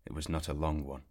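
ordinary delivery

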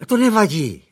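high, airy lady of the house; light, high motherly reassurance. A narrator's reading. Mother reassuring the butler, high and light. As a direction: brush it off brightly.

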